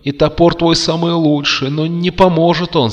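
высокий громкий уговор; упрашивай высоким голосом; умоляющий узник. A narrator's reading. Узник уговаривает девушку. (взволнованные уговоры)

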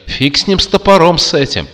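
высоко, громко, скороговоркой к концу; отмахнись визгливым голосом; визг. A narrator's reading. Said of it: "завизжали из ящика". (визгливая паника)

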